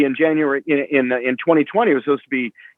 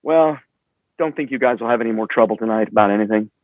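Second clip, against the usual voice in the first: lower, cool, understated menace; calm Bill; 6 semitones lower than usual. Retold or imitated. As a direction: promoter reassuring the band coolly. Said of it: "and he goes".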